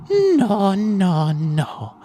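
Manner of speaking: drawn-out descending no's; slow hammed-up denial; mock refusal. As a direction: stretch each no, starting high and loud. Roommate refuses escaping captive.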